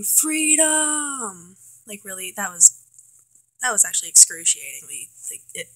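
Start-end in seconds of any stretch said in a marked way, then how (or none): 0.0-1.6 high, drawn-out martyr's cry, held back in volume; William Wallace. Retold or imitated. Reviewer quoting the hero's last words, high mock cry. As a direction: imitate a stretched-out final cry, pitched high. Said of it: "his last words being".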